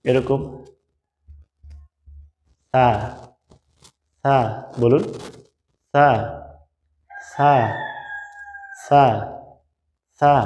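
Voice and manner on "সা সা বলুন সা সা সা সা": সা said thin, with a light breath of air; crisp drill of সা, breathy and light; repeat-after-me drill. Shown as a demonstration. Teacher drilling students on সা, thin and breathy. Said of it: "আমার সাথে একটু বলুন".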